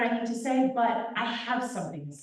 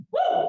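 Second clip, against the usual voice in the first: high, loud "woo"; short exhilarated whoop; 6 dB louder than usual. Real, not acted. Lets out a whoop mid-speech.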